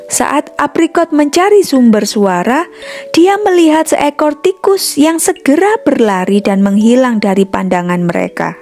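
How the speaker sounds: plain reading voice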